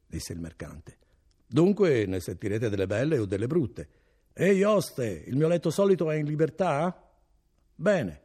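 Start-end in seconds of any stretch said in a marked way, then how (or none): 1.4-8.2 higher, louder merchant calling out. A narrator's reading. Merchant calling to the innkeeper for his bed, higher and louder. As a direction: order the host about with authority.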